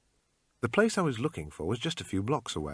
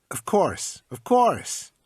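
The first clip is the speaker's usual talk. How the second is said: high, slow salesman pitch; pawn shop salesman; 10 semitones higher, 5 dB louder, much slower than usual. Acted. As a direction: sell it like a pushy clerk. Shopkeeper haggling.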